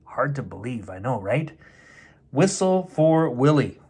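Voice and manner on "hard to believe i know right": ordinary delivery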